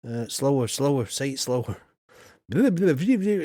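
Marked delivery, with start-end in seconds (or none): 2.5-3.4 high, swooping babble imitating garbled speech; mock Klingon gibberish. Streamer mimicking a too-fast robot voice, mock gibberish. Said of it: "It sounded like Klingon".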